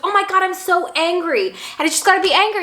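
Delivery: mock venting; lightly voiced frustration; retold anger. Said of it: "I was like".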